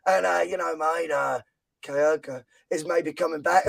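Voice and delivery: low, soft Australian accent impression; Ange. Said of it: "he says it in this mad accent".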